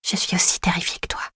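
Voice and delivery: tiny breathy whisper; whispering to calm a nervous horse. Acted. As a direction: whisper so only the horse can hear. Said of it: "je chuchote d'une toute petite voix".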